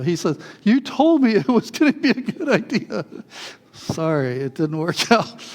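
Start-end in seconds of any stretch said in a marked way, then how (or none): 0.6-3.0 high, accusing whine, half laughing; indignant protest. Retold or imitated. Younger brother blaming him after the tumble, high indignant protest. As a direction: protest like a wronged kid brother.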